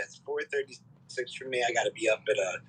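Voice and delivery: normal talking voice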